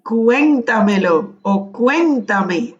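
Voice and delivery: slow "Cuéntamelo" and "cuéntame", CUEN drawn out; stress on first E. Shown as a demonstration. Teacher repeats student's example, CUEN stressed slowly. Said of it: "con acento en la primera E".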